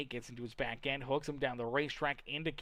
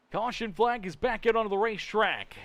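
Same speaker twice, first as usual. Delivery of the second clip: raised, loud caution call; excited race announcer; 6 semitones higher, 7 dB louder than usual. Real, not acted. Race commentator announcing the caution flag after a crash, excited.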